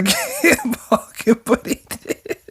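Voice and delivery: high, slow words dissolving into laughter; real laughing. Real, not acted. Reader tries to read the crash dialogue, laughing too hard. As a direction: struggle to read through the giggles. Cracking up while reading on.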